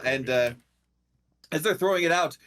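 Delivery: ordinary talk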